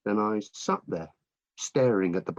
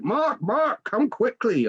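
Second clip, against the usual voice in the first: urgent calling out; slower than usual. A narrator's reading. Wife calls husband to their son's bedroom, urgent calling out. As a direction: call someone over urgently, voice leaping high. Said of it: "when Claire called out".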